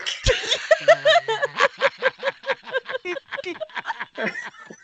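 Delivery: long high wheezing laugh; real high-pitched giggling fit; helpless laughter. Real, not acted. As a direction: crack up completely. Table laughs at the stick line.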